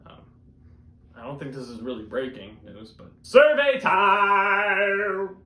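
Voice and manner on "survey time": slow, loud, drawn-out announcer call; stretched-out hype call; survey time jingle. Acted. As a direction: belt it out like a game show bumper.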